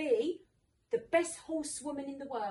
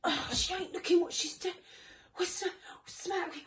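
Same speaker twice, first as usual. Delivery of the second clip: mock high, loud yelling from the sidelines; imitating the father bellowing at his daughter on the pony; mock frantic dad; 8 semitones higher, 12 dB louder than usual. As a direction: ham up an overexcited dad shouting at his kid. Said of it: "he sits next to me like".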